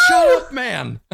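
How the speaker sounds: high, loud comic outburst; mock angry shout. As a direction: yell back at the nagging conscience. Acted quarrel with the angel.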